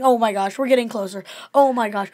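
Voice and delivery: loud, hammed-up alarm; mock alarm. Co-host retells Monk nearing the spit step, loud mock alarm. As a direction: raise your voice in mounting mock dread.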